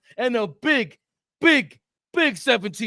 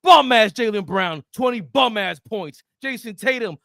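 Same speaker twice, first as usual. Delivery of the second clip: usual speaking voice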